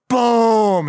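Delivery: loud, high, drawn-out boom; booming impact. Retold or imitated. Fist pounds the table, loud imitated boom. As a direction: imitate a huge thud with the voice.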